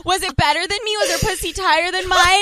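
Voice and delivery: hammed-up nosy girlfriend interrogating; mock eager prying. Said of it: "I'm like".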